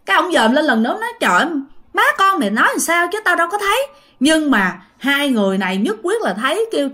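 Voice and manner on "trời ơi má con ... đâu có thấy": high, exasperated protest; exasperated disbelief. Acted. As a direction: protest in bafflement, raised pitch. Baffled husband snaps at son.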